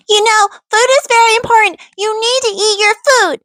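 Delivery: put-on silly, very high babyish whine. Shown as a demonstration. Mom scolding her goofing-off kids, in a silly high baby voice. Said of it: "I talk to them in this voice".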